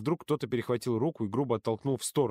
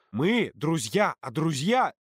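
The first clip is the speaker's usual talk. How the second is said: drawn-out, raised protest; slow, higher defensive plea; insistent protest; 5 semitones higher, 4 dB louder, much slower than usual. A narrator's reading. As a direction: stretch the words out insistently. Defending himself in the quarrel.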